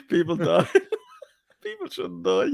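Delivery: laughing. Real, not acted. Mocking friend while laughing.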